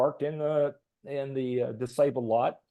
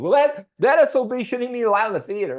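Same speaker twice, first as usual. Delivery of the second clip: high-pitched, loud indignant outburst; imitating an irate vet ranting at the manager; angry rant; 11 semitones higher, 6 dB louder than usual. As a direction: insist she shouldn't be allowed in. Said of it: "he just went on and on".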